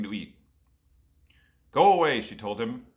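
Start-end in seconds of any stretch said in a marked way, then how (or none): 1.7-2.2 stern refusal. Acted. Wife turns Jack away.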